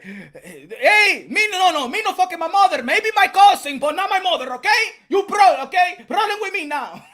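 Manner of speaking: put-on loud, high Mexican-accented broken English, laughing through it; a hammed-up, shouted Mexican accent; mock Mexican-accented yell. Imitating Canelo for the chat in a loud Mexican accent. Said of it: "Canelo Alvarez be like".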